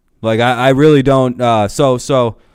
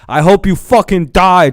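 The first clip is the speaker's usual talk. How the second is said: raised, higher heckler's yell; mock crowd yell; 5 semitones higher, 4 dB louder than usual. Crowd yelling at the players, mock heckling yell. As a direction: yell it out like an angry fan. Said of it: "the crowd yelling".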